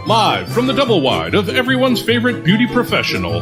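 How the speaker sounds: ordinary talk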